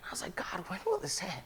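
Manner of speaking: a worn-out plea breathed to God; whispered prayer. Retold or imitated. Sick pastor pleading with God in a whisper. Desperate prayer during long sickness.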